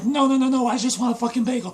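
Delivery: high, loud, rapid mock protest; frantic pleading. Beaten character protests during acted fight.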